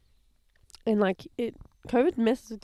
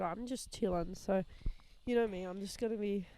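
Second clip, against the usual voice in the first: ordinary talk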